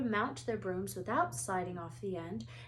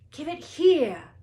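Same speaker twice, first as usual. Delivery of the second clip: much louder, higher yell; 7 semitones higher, 13 dB louder, slower than usual. A narrator's reading. Harry yells at Malfoy for the Remembrall. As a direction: yell up at him. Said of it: "Harry yelled".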